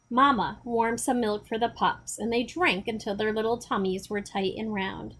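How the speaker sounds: plain reading voice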